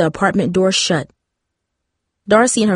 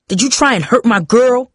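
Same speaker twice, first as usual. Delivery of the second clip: higher, confrontational demand; angry growl; 5 semitones higher, 3 dB louder than usual. A narrator's reading. Father confronts the boy over his daughter, angry growl. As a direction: challenge him as a protective father. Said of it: "Dad growled".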